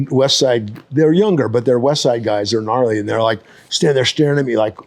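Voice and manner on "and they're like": ordinary delivery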